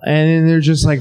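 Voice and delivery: ordinary talk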